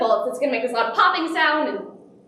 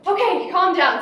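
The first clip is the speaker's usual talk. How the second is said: higher, tense retold instruction; mock stressed mother; 6 semitones higher, 4 dB louder than usual. Super stressed mom in the car, mock flustered. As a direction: imitate a frazzled mom taking charge. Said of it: "super stressed".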